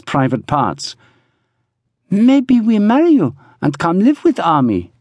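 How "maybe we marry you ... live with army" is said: put-on high accented woman's voice; proposing woman. A narrator's reading. Woman suggesting marriage to Brigg, high voice. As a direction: float the idea in high pitch.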